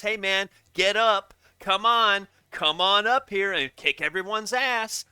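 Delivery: loud, slow mock PA call; hammed-up track announcer summoning the racer. Mocking how the track should call the racer, loud. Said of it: "you gotta call him more than once".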